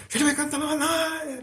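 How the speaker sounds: high-pitched, sustained tongues chant; Pentecostal tongues intonation. Shown as a demonstration. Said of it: "até a entonação da voz". (imitação aguda da entonação de quem fala em línguas)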